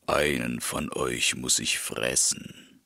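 low wolf voice, demanding; hungry wolf, low and threatening; menacing wolf. A narrator's reading. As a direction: loom over the rams.

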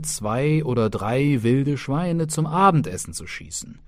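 plain reading voice

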